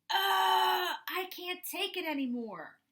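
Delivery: high, loud snapping point; exasperated outburst. Acted. Dad at his limit after the crash, exasperated outburst. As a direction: blurt it like your brain just popped. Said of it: "he said".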